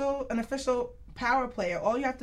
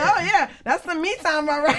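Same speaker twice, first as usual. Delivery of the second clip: teasing quote; 7 semitones higher, 8 dB louder than usual. Retold or imitated. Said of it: "Somebody said".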